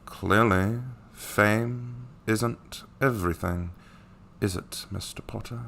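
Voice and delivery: slow drawl with heavy pauses between words; Snape. Acted. Teacher needling Mister Potter, slow Snape drawl. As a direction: draw out each phrase, softening toward the end. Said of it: "Snape, you don't need to end every".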